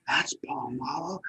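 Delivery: hushed, breathless whisper of a fan; awed whisper. Retold or imitated. Viewers whispering in awe at the guest. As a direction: whisper it like a star-struck viewer. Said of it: "they're gonna say".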